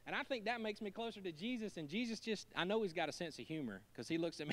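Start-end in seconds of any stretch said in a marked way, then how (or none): none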